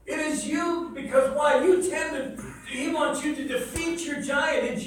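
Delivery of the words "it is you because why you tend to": ordinary talk, nothing marked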